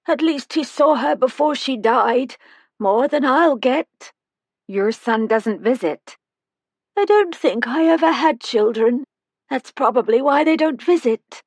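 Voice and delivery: put-on a tiny old woman calling from the doorway; high-pitched old woman. A narrator's reading.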